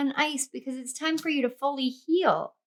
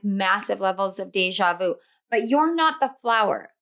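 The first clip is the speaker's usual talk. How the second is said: normal talking voice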